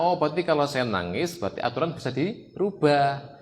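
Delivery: put-on the kid realizing crying wins; child's triumphant realization. Child realizing crying changes the rules, gleeful. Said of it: "Ini senjata".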